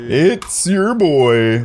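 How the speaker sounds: slow, drawn-out copy of the line; imitating the voice line. Shown as a demonstration. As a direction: echo it back, stretched out. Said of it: "speaker repeats the voice line".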